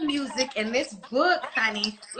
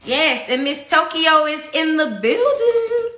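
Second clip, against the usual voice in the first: slow, louder hype-man intro; hype announcement; 3 semitones higher, 6 dB louder, slower than usual. Real, not acted. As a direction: announce her like a star arriving. Hyping the guest's arrival.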